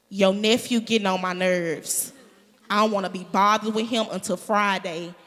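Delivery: fed-up complaint, pushed out loud; loud venting. Acted. Mom calls a relative about the nephew, loud venting. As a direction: lay it down firmly and loudly, acted.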